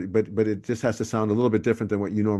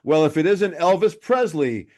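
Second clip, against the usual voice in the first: lightly acted, higher-pitched scene partner; improviser's setup; 6 semitones higher than usual. Improviser puts a scene partner on the spot, lightly acted higher setup. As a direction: toss out the cue in a raised, announcing pitch. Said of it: "into a scene and someone goes".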